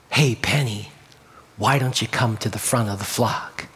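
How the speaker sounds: low, soft, tender shepherd's call; gentle. A narrator's reading. Good Shepherd inviting the timid lamb forward, gently. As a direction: lean in kindly, voice dropped low and quiet. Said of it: "he spoke more gently to her".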